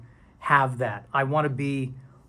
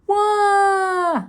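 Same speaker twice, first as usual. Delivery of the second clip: high, drawn-out plaintive wail, building louder; whiny pleading; 6 dB louder, slower than usual. Retold or imitated. Imagining scrolled-away viewers, a rising high plaintive wail. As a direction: beg them not to leave in a high wail. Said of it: "you know what I'm doing in my head? I'm like".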